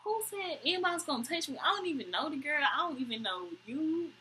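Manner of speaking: lightly voiced indignant dude; imitating the date. To the bartender, a mild mock protest. Retelling her date's protest.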